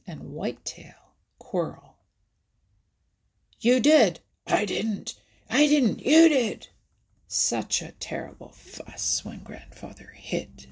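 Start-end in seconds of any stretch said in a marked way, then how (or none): 3.6-6.7 loud, high back-and-forth squabble; acted childish did-didn't row; quarrelling. A narrator's reading. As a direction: bicker hotly, as two birds in a spat. Two birds quarrel.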